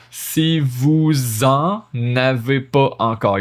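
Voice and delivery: "vous en" linked slowly as "vou-zen"; slow liaison vous en. Shown as a demonstration. Teacher slowly showing the liaison to students. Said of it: "linking the S of vous to the en".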